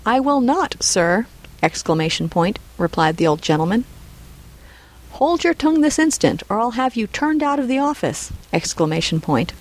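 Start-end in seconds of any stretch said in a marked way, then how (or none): 0.0-1.3 higher-pitched, mildly indignant retort; defiant refusal. Acted. Reading a quarrel from Dickens. 5.1-8.3 high-pitched, mildly angry order; indignant threat. Acted. Fang threatens in novel quarrel.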